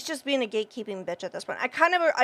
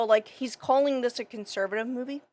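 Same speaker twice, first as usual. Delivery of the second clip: normal talking voice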